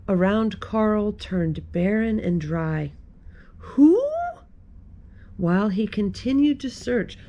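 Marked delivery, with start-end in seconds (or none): 3.6-4.0 loud, high questioning call. A narrator's reading. Carl calls out while searching.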